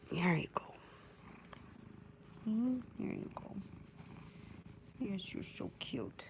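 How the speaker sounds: tender whisper. Real, not acted. Cuddling her cat, whispering tenderly. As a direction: whisper sweet nothings to the cat.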